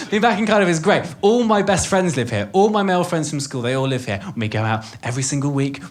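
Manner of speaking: ordinary talk, nothing marked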